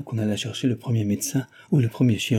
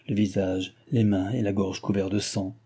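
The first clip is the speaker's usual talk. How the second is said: ordinary delivery, nothing marked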